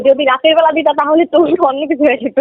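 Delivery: usual speaking voice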